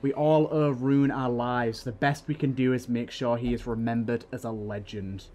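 steady, dramatic storytelling read; story narration. A narrator's reading. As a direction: draw the listener into the battle. Reads second diary aloud.

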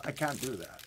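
ordinary talk, nothing marked

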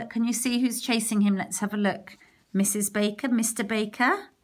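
plain reading voice

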